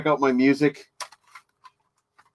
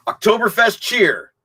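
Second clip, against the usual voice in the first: higher, louder title call; announcer voice; 8 semitones higher, 5 dB louder than usual. Real, not acted. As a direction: announce the song proudly.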